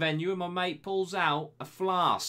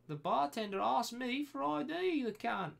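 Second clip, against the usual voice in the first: high-pitched, softer mock Australian accent; 9 semitones higher, 5 dB quieter than usual. Host reading the bartender story in an Australian accent. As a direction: read it on in a put-on Australian accent. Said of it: "should I finish this in an Australian accent".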